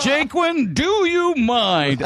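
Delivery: high, drawn-out comic protest; Marx Brothers indignation. Retold or imitated. Wine-soaked husband to the clumsy guy, hammed-up indignant protest. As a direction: stretch it out like an affronted old-movie comic. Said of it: "right out of a Marx Brothers movie".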